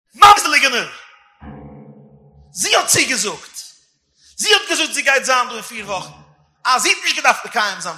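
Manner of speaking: high, loud, heated accusation; angry shouting. Acted. Quarrel between father and son over who lied, angry shouting. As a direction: shout the blame out in fury.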